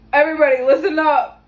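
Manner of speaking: loud booming call to the room; commanding deep voice. Retold or imitated. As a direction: imitate the commanding man grabbing the class. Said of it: "commanding, masculine, deep voice".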